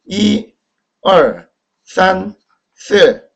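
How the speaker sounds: 이 and 산 high and level, 얼 and 스 falling; exaggerated Chinese tones, each numeral apart; Mandarin pronunciation demo. Shown as a demonstration. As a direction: recite 이, 얼, 산, 스 slowly with clear tones. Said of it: "여기까지 했네요".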